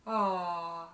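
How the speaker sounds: long sighing "ah", falling away; sighed ah. Shown as a demonstration. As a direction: let out an open "ah" on a sigh. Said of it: "when we sigh, we make an R sound".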